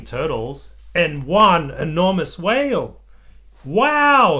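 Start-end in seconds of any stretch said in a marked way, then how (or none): 3.6-4.4 high, loud delighted wow; excited wow. A narrator's reading. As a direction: gasp it out in delight. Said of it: "said Peppa".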